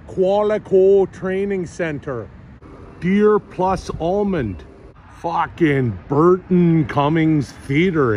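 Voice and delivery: put-on slow, drawn-out Canadian accent reading signs; exaggerated Canadian accent, savouring every word. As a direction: read each sign aloud in a hammed-up Canadian accent. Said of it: "reading signs in my Canadian accent".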